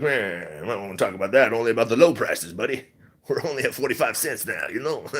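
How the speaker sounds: lightly put-on grumbling naysayer voice; mock naysayers. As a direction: imitate dismissive naysayers, lightly. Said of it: "they're like".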